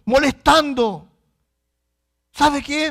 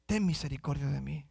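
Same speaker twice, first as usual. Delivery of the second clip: very soft, low plea; humble supplication; 9 semitones lower, 11 dB quieter than usual. Acted. Believer begging God for mercy, humbly hushed. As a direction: pray it quietly, sinking lower. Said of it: "clamar a Dios".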